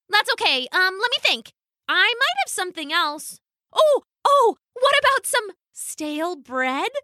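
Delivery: high, giddy duck voice; chirpy duck. A narrator's reading. The duck teases Bailey again in a high giddy voice. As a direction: play dumb like a cheeky duck.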